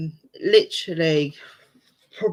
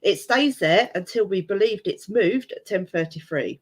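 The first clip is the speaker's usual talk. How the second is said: ordinary talk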